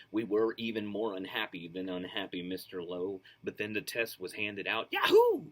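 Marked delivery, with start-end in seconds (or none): 4.9-5.5 loud high cheer; yelling. Acted. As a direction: yell it with glee. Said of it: "we yelled".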